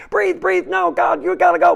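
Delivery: very high, loud panic; desperate high yelling; panicking reptile brain. Pretend. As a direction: shriek in terror to get out. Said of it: "the reptilian brain starts to go".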